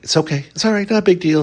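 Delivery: high, airy brush-off; breezy mock reassurance. Dee playing it cool toward Angela, airy brush-off. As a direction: pretend it's no big deal. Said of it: "Dee is playing like".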